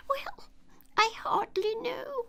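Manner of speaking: very high, weak squeaking voice; Bill the lizard, feebly answering; feeble squeak. A narrator's reading. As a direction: squeak it out, dazed and faint. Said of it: "a little feeble, squeaking voice".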